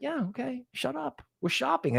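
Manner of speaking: high, soft dismissive mock voice; imitating Toby. Cohost mocking a whiner, dismissive. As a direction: brush it off, lightly voiced. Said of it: "She's like".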